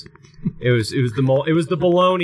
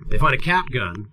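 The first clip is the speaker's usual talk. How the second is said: ordinary talk, nothing marked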